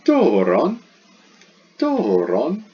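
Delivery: "Doron" loud and high, first vowel gliding up then down; teacher's emphatic demo of "Doron", pitch curving on DO; circumflex on first syllable. Shown as a demonstration. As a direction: punch out "Doron" with a bending DOH. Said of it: "It goes up and then it goes down".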